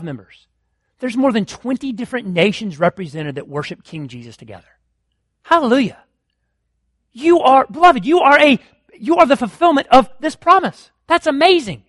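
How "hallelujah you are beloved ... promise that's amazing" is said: higher and louder, joyful exclamation; excited praise. Real, not acted. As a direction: lift it up, celebrating the church. Preacher exults over congregation.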